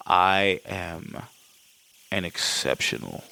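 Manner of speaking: very low, halting attempt at Hans's accent; deep villain accent, fading to a whisper; Hans's accent, slipping. Podcaster retrying Hans's line, low shaky grip on the accent. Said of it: "I lost the fucking accent".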